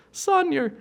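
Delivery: high, patient puppet mother voice; mother polar bear. Acted. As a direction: reassure the cub in a high puppet voice. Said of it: "And the mom goes".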